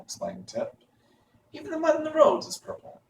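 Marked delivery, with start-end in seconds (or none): 1.5-3.0 high. Acted. Tip's line, voice jumps high.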